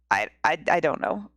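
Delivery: low, hesitant stammer; lightly voiced baffled husband. Husband answering his wife, a low baffled stammer.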